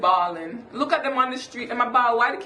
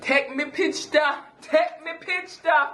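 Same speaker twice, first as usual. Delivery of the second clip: "Take me picture" slow, higher, in Jamaican Patois; 6 semitones higher, slower than usual. Shown as a demonstration. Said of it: "How do you say take a picture in Jamaican".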